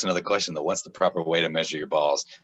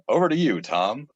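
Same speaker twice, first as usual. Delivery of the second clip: bright anchor handoff, punched opening; newscaster voice; 7 semitones higher, 4 dB louder than usual. Retold or imitated. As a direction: toss it to the next anchor. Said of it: "in the newscaster voice".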